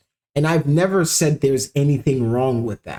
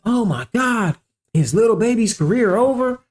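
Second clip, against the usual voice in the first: high-pitched mock panic; mock alarm; 8 semitones higher than usual. Critic mimicking fans panicking over album sales, mock alarm. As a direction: act out overblown public alarm, pitched up.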